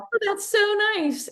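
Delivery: high, slow, lightly voiced gracious reply; a hint of a charmed star, drawn out high; imitating the actress. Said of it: "And she's like".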